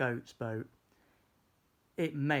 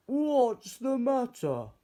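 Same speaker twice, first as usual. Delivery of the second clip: higher, louder, slow question; concerned call; 12 semitones higher, 7 dB louder, slower than usual. Acted. George calling to the goat on the boat, concerned.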